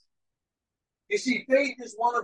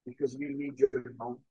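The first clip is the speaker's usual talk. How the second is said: low, soft, earnest prayer; 10 semitones lower, 6 dB quieter than usual. Real, not acted. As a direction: pray quietly to God, pitched low. Leads opening prayer.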